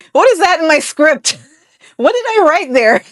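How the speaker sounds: high-pitched, cracking up between questions; baffled outburst broken by laughter; laughing disbelief. Real, not acted. Host reading own script, laughing in disbelief.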